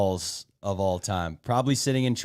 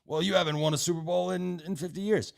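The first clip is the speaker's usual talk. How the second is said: higher, mocking imitation of the Bills caller; 7 semitones higher than usual. Host mocks a rival caller's Super Bowl jab, sneering imitation. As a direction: parrot his argument back with a sneer. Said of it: "you were starting off on some".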